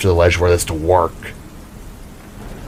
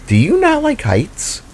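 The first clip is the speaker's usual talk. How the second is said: much higher, slow teasing question; Jada teasing; 13 semitones higher, slower than usual. Acted. Jada teases Void about his fear of heights, high and slow. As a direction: draw it out high like a curious girl. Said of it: "Jada questioned".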